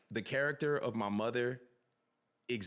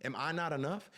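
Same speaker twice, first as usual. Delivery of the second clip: wife's frustration; 6 semitones higher than usual. Retold or imitated. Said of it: "She's like".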